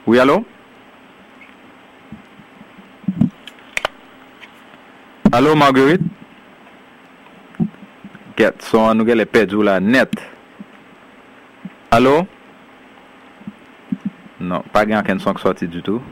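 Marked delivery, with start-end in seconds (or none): 0.1-6.0 loud, higher calling out; to someone hard of hearing. Real, not acted. Host calling over bad phone line. 11.9-12.3 loud, higher calling out; to someone hard of hearing. Real, not acted. Host calling over bad phone line.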